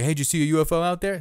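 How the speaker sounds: loud retold ribbing; joking taunt. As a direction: imitate jokers calling out a quick ribbing question. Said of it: "the people always joke".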